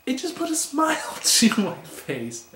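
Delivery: high-pitched, laughing mid-sentence; delighted laugh breaking through; real amusement. Real, not acted. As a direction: let a laugh lift the voice right up. Amused recalling the dark joke.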